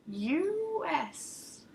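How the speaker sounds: a lightly voiced old crone, unsure; puzzled crone. Crone to stranger, lightly voiced puzzled echo. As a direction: echo it back like a baffled old woman.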